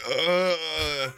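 drawn-out groan. Retold or imitated. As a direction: let out a long, put-upon "ugh". Reenacting weary 3 a.m. car shuffle.

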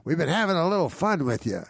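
high, sing-out prank reveal; imitated jolly host. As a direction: play the host letting everyone in on it.

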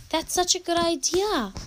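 mild excited shout. A narrator's reading. Mary answers Eliezer's idea, mild excited shout. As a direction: pitch it up like a delighted storybook shout. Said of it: "Mary shouted".

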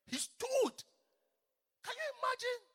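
high, loud cry of wonder; awed exclamation. Acted. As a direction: marvel out loud to the church. Preacher exclaims in awe.

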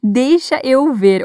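"Deixa eu ver" drawn out slowly and clearly; everyday spoken Portuguese; reduced Portuguese. Shown as a demonstration. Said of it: "ou "Deixe-me ver".

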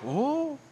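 high, soft rising "ooh"; quiet swooping-up ooh; crowd's "ooh". Retold or imitated. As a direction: imitate a hushed crowd's intrigued reaction. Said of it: "The plane went".

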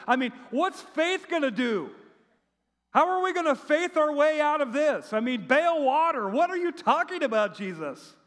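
high, exasperated mock protest; incredulous protest. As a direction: argue back in disbelief. Acts disciple protesting to Jesus.

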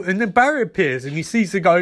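normal talking voice

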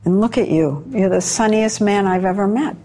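usual speaking voice

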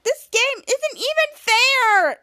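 high, slow, drawn-out whine; whiny complaint. Retold or imitated. Sister whining to the team that it's unfair. Piper complains during the game.

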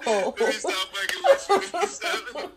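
cracking up mid-sentence; high laughing through the words. Real, not acted. Laughing after teasing her.

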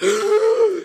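high, loud gasp for air; imitated gasp. Student pulled up from the eye wash, mock gasp. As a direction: gasp as if surfacing from water. Classmate coming up from the water.

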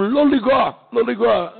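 high, urgent mock plea from the doctors; urgent doctors' plea. Doctors at the bedside pleading urgently. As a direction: doctors begging him not to touch, pitched high. Doctors urging the boy's father.

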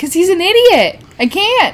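high whiny voice refusing; quoting herself refusing a guy, whiny; mock whiny refusal. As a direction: whine it like a stubborn teen. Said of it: "And I'm like".

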